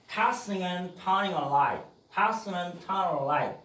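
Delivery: tossing and turning a line을 느리게 이어서; 천천히 붙여 읽기; slow linked demo. Shown as a demonstration. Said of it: "그래서". (느린 연음 시범)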